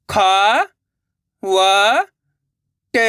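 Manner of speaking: खा, व, ट drawn out with gaps; syllable by syllable. Shown as a demonstration. Teacher breaking a word for learners, syllable by syllable. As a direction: sound out खा, व, ट singly. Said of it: "एक अक्षर का उच्चारण करके".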